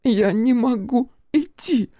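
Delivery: high, slow, whispery whimper; sobbing. A narrator's reading. Fiona refuses to walk and appeals to Crow, sobbing. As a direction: sob it out slowly in a small high voice. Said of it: "всхлипнула она".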